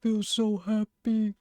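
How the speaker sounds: slow, drawn-out contented creature voice; big beast blissfully happy; happy chuckling monster. Pretend. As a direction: say it slowly between deep chuckles. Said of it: "Deep chuckle".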